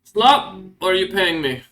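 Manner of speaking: loud, high first word; guard calling out. Acted. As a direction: bark the choice at a prisoner. Guard calls meal choice to prisoner.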